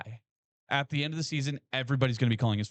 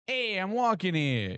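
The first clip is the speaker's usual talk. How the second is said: mock Boston accent; 5 semitones higher, slower than usual. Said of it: "with a fun accent".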